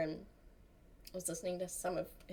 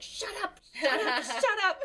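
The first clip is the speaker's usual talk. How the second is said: laughing mock yell; 11 semitones higher, 5 dB louder than usual. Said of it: "I just screamed at him to shut up".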